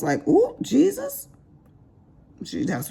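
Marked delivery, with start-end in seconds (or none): 0.3-1.3 much higher, louder, slow mock gasp of shock. Hearing the client call her husband an ass, shocked squeal. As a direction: replay your stunned reaction, stretched out. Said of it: "and I was shocked".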